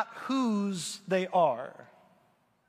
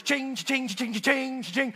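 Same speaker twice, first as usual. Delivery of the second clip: ringing, repeated cha-ching noise; imitated cash-register chings; 4 semitones higher, slower than usual. Homeowner hearing the cost of digging up roots, mock chings. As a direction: chime out each one like money racking up. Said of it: "all I hear is".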